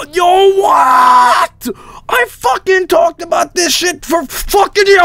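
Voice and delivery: high-pitched shouted disbelief; astonished outburst. Real, not acted. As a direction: explode in incredulous amazement. Erupts at seeing game.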